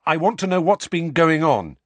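put-on high, loud, insistent boy; eager demand. A narrator's reading. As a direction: blurt it out insistently. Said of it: "Harry said at once".

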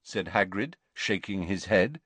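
usual speaking voice

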